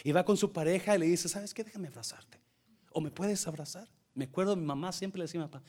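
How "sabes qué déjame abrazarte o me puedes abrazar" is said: much softer, intimate plea for a hug; tender request. Retold or imitated. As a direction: ask your partner gently, almost hushed. Asking partner for a hug tenderly.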